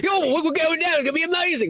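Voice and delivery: high, hyped-up Australian voice; excited Aussie impression; Aussie dude. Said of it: "like, Aussie dudes possible".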